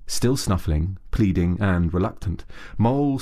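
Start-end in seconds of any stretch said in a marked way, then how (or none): none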